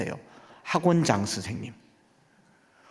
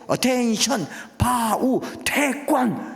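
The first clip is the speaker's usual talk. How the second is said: taekwondo drill calls; 9 semitones higher, much slower than usual. Retold or imitated. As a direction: call it like a dojo instructor. Taekwondo commands in English.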